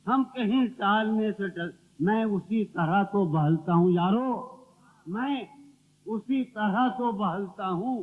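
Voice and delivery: declaimed verse with pauses after key phrases; ghazal recitation. Acted. Poet reciting to his friends at a mushaira, declamatory recitation. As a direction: offer each line to the audience.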